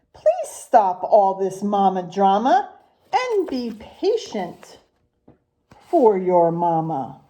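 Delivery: sing-song rhyming picture-book read, unhurried; reading to children. Acted. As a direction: read the rhymes to the class with a lilt. Teacher reads picture book aloud.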